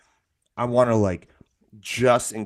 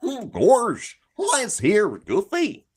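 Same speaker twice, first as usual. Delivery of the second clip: high, swooping cartoon voice; slow, hammed-up cartoon goofball; Goofy; 10 semitones higher, much slower than usual. Trying a cartoon voice from a friend's shirt, hammed-up and high. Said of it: "That was bad. Why did I go like".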